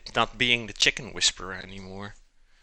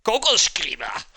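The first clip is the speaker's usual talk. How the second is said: "Coco Scriba" loud, high and harsh mock German; 6 semitones higher, 4 dB louder than usual. Shown as a demonstration. Streamer mocking German words for pen, loud. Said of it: "And then German, it's like".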